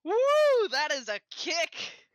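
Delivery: high loud whoop, then slow relish; whoop, then savour the words in character; exclaiming at a spicy kick; whooping reaction. Acted. Player reacts in character to spice.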